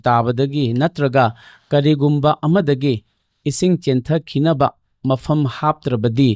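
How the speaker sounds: slow, measured read-aloud with pauses between phrases; deliberate question-reading voice, pausing often. Real, not acted. Reads out a submitted question.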